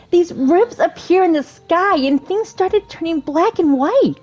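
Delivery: high-pitched princess voice; Rapunzel, eager and friendly. Acted. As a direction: read it as a breathless, eager game heroine. Reads Rapunzel's dialogue in voice.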